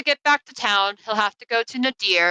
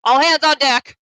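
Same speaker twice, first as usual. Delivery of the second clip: high, louder baker's rallying cry; urgent calling; 7 semitones higher, 6 dB louder than usual. Acted. Baker turns to his husband, calling out urgently. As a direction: call out in wide-eyed alarm. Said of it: "turn to his husband, and be like".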